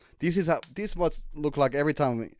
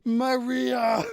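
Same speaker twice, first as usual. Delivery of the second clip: higher, laughing cry of the name; called-out name; 11 semitones higher than usual. Real, not acted. Shouting a character's name.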